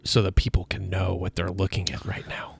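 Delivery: hushed aside whisper. Acted. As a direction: whisper like in a courtroom. Tour guide aside at trials.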